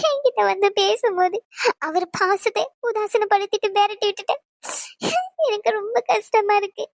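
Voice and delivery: tearful, halting confession; crying. Acted. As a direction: choke out your guilt through sobs. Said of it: "அழுகாத, எல்லாரும் நம்மளதான் பாக்குறாங்க".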